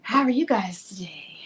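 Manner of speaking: unvoiced whisper to the viewers; whispered greeting. Real, not acted. Host whispering a hello to her live viewers, hushed. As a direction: whisper the hello as if sharing a secret.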